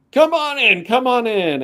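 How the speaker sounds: high, loud, sing-out invitation; welcoming call. Acted. Kim calls to newcomers at the door, heartily welcoming. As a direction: usher everyone in from the doorway.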